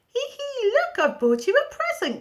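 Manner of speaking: very high giggling voice. A narrator's reading. Jack giggles giving his mum the hen, very high. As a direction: giggle as you hand it over. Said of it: "giggled Jack".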